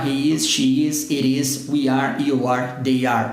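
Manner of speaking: ordinary talk, nothing marked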